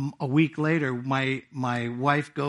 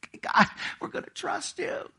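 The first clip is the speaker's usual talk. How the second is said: hushed prayer; 11 semitones higher, 4 dB quieter than usual. Retold or imitated. Vow repeated years later.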